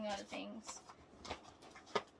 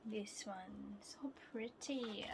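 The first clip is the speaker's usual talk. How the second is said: whisper; 9 dB quieter, slower than usual. Real, not acted. Quiet admiring of album.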